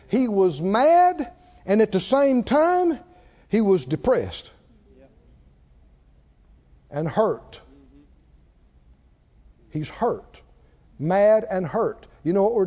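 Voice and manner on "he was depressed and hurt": ordinary delivery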